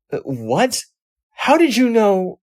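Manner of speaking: high, loud shocked question; startled. Acted. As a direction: caught off guard, voice jumps up. Startled by robot reading mind.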